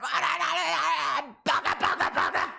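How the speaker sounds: high, shouted mock wrestler cry; mock Asuka cry. Imitating Asuka's ring catchphrase in a high shouted mock cry. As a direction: shriek it like Asuka mid-match. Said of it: "Asuka's like".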